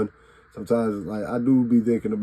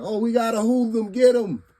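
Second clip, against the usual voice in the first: high, frantic voice of a coworker calling security; mock alarm, much higher; panicked bystander; 12 semitones higher than usual. Coworkers panicking and calling for security, high mock alarm. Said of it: "I'm like".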